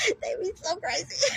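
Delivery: high giggling laugh through the words. Real, not acted. Laughing at the people she just mocked, high giggles. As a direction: crack up at her own mockery. Laughing at her own impression.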